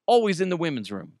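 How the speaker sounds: usual speaking voice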